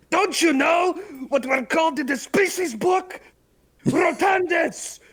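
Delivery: yelling. Acted. NPC yells while pinned down.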